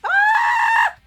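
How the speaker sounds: sudden high shriek; startled shriek. Real, not acted. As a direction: shriek in alarm. Shout while icing.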